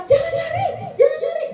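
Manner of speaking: put-on high, urgent call to a child; parent's warning cry. Parent stopping a child running on a slippery floor, urgent high cry.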